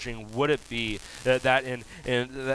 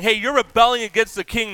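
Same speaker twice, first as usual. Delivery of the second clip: accusing opponents; 8 semitones higher, 7 dB louder than usual. Retold or imitated. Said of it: "are all saying".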